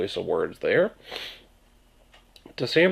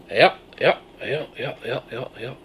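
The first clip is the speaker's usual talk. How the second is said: Maine yup; 2 semitones higher than usual. Shown as a demonstration. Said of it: "Instead of yes, yup".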